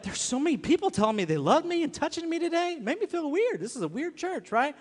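high-pitched mock complaint of a kid; imitating an uncomfortable kid. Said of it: "he'll be like".

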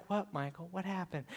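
very soft, concerned acted question; hushed, kindly teacher impression; gentle teacher. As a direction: ask a child softly what is wrong.

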